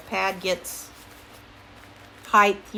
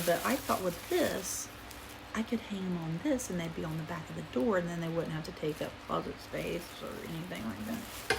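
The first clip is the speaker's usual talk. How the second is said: hushed, softer voice so nobody hears; quiet, careful talk; talking quietly; 2 semitones lower, 7 dB quieter than usual. Real, not acted. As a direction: keep it down as if someone's in the next room. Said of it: "Sorry, I'm talking quiet".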